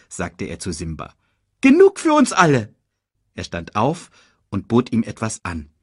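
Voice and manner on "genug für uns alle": high, quick cartoon meerkat; chirpy little sidekick voice. Timon speaks.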